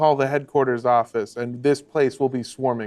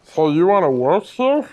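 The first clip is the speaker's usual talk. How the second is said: lightly voiced wheezy boss, a little higher; heavy-breathing editor; 4 semitones higher, 4 dB louder than usual. Editor sizes up a job applicant, breathing heavily. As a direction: heave a breath, then ask the applicant. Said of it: "breathes heavily and goes".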